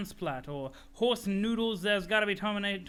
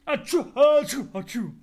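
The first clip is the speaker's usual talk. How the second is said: high, loud hammed-up sneezes; cartoon allergy sneezes; loud fake sneezes; 7 semitones higher, 12 dB louder than usual. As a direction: sneeze twice, big and high. Character sneezing.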